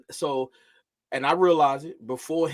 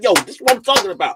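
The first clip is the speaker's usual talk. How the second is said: raised, high-energy self-demonstration; louder and higher, pushing each phrase; passionate talk; 6 semitones higher, 5 dB louder, faster than usual. Shown as a demonstration. Parent showing son how he talks, with loud passion. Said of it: "I'm talking with some passion".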